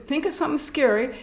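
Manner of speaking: usual speaking voice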